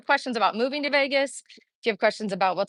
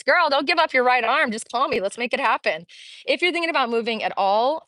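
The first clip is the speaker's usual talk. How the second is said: ordinary talk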